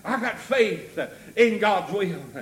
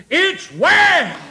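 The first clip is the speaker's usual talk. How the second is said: high, loud proclamation; preaching shout; 10 semitones higher, 8 dB louder than usual. Retold or imitated. Preacher proclaiming the woman's answer, shouted. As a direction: burst out with it at full voice. Same words repeated as shout.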